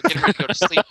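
normal talking voice

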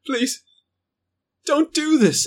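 high, strained plea; thin high begging, well above normal; high pleading creature voice. Acted. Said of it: "but in a darker tone".

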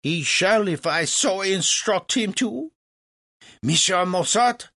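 high, commanding French voice; imperious French impresario. Acted. Legras turns to command Mozart, imperious and high. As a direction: assert your authority grandly.

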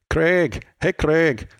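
put-on Scottish call of the name; Scottish accent. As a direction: mimic a Scottish greeting, rolling into Craig. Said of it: "I've got a Scottish friend".

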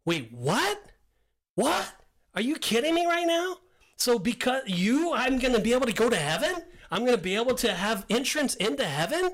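high-pitched disbelief, questions rising sharply; baffled "wait, what?" reaction; incredulous Nathaniel. Acted. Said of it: "if I'm Nathaniel, I'm going".

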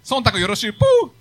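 comic character read through laughter; light, casual wrestler. Acted. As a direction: play the cheeky wrestler, ending on a high whoop. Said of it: "そんな喋り方なんですか？".

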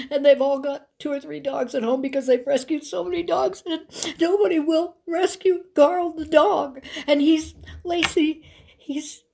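high, halting imitation of tearful pleading; acted-out high, sobby phone plea; weeping, hysterical friend. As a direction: retell it as a distraught caller, stumbling and restarting. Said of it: "she is weeping. She is crying".

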